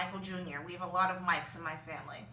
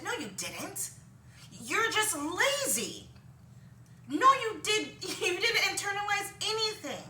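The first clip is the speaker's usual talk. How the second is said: exasperated; 9 semitones higher than usual. Real, not acted. Exasperated rant at whiners.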